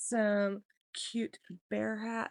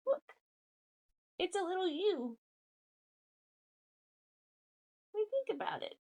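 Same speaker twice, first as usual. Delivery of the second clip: high, cooing questions to a dog; pet talk; 6 semitones higher, faster than usual. Real, not acted. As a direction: coax a sleepy little pet, pitched up.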